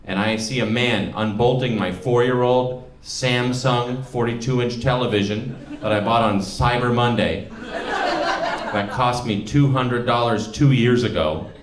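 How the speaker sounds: ordinary talk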